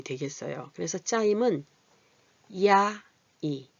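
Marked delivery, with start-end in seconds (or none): 2.7-3.7 야 and 이 drawn out very slowly, apart; spelled-out vowel parts. Shown as a demonstration. Teacher slowly splitting the vowel into parts. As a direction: stretch 야 then 이 slowly for the class. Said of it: "야에다가 이를 붙인 소리고".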